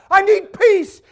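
high, loud mock pleading. Christians coming to God, loud mock pleading. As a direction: whine it at a shout, very high. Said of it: "Christians coming to God and say".

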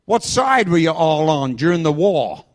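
loud Alabama questioning; mock Alabama accent. Driver asking about the war, mock Alabama accent. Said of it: "This accent is a Vaughnabama accent".

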